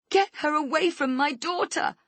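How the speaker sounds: high, loud, raging scream; mother screams to save her daughter; furious scream. Acted. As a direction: scream it at her in sudden fury. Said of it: "I scream at Rhonda".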